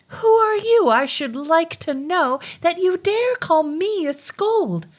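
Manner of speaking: high, slow, scornful tirade; angry princess. Acted. Turritella insulting the king, high and slowly scornful. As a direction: look down on him, drawn-out and shrill in pitch. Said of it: "cried Turritella angrily".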